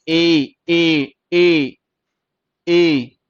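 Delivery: ए held long, each one separate; long vowel. Shown as a demonstration. Teacher demonstrating the ए sound, long vowel. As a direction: slowly draw out every ए. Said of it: "ए की sound आ रही है".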